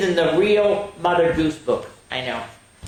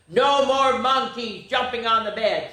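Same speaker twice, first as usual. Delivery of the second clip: doctor's stern voice; 8 semitones higher than usual. Acted.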